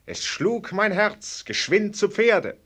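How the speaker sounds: theatrical verse recital; reciting verse. Acted. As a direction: declaim Goethe with mock grandeur. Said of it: "der diesen Vers dichtete".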